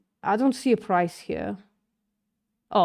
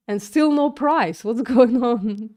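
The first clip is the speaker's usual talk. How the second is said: laughing; 4 semitones higher, 5 dB louder, slower than usual. Real, not acted. Laughing at missing price.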